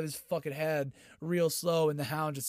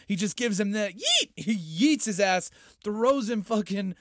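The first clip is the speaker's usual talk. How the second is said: ordinary talk, nothing marked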